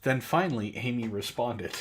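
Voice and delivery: normal talking voice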